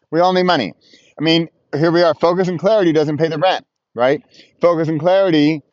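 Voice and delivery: ordinary delivery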